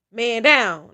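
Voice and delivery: loud, punched mock call; joking mock quote. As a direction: call it out big, as a joke. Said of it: "Frankie reply was".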